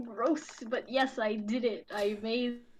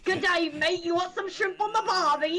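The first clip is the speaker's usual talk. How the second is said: hammed-up Australian, higher and louder; over-the-top Australian accent, raised voice; mock Australian accent; 8 semitones higher, 5 dB louder than usual. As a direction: play the tourist-cliche Australian.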